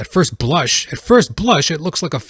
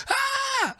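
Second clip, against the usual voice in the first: high-pitched held scream; mock scream; 4 dB quieter than usual. Comedian describes the kid being hugged, screaming in mock horror. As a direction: let out a horrified shriek, hammed up. Screams at the poster once more.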